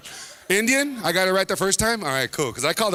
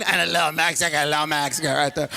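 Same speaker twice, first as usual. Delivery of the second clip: mock sheepish, lower mumble; downplayed afterthought; 5 semitones lower than usual. Mocking him for hiding his Mexican side, downplayed. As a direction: tuck it away like a minor detail. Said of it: "You went the other way".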